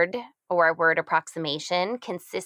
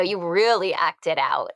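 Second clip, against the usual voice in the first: usual speaking voice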